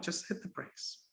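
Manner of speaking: sudden near-whisper; hushed. Real, not acted. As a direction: drop to a whisper to urge restraint. Preacher urging restraint in anger.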